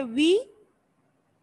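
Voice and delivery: विशियस drawn out slowly, syllable by syllable; pronunciation demo. Shown as a demonstration. Teacher showing students how to say विशियस, slow and drawn out. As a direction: stretch out विशियस so each syllable is heard. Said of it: "या फिर आपने इसे कैसे pronounce करना है?".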